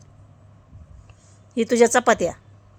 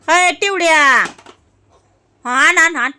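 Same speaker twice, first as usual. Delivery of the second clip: calling out; 4 semitones higher, 5 dB louder, slower than usual. Real, not acted. Calling the child to bring.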